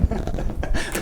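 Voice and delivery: giggling at her own cheeky remark; breathy laugh. Real, not acted.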